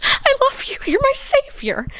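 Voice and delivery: high-pitched, hammed-up tearful squeal; mock crying. Grateful child to rescuing mom, mock crying. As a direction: imitate a child blubbering with grateful love. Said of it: "I'm still crying".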